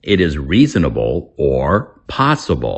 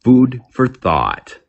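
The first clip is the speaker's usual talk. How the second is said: slow careful demo; 7 semitones higher than usual. Shown as a demonstration. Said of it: "Common English expression".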